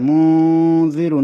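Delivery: slow, loud chanted tajweed reading of মুনজিরু; stretched recited মুনজিরু; Quran recitation. Shown as a demonstration. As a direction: draw out মুনজিরু slowly with the nun hidden in the nose. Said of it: "নাকের মধ্যে নিয়ে দুই আলিফ সমান রাখতে হবে".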